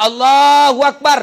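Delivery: long, slow, loud chanted takbir; takbir demo. Shown as a demonstration. Preacher demonstrates the funeral prayer to the congregation, slow loud chant. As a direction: call it out slowly like an imam leading prayer. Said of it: "Kursus sholat jenazah".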